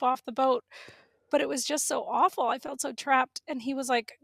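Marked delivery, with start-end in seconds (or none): none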